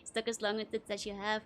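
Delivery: usual speaking voice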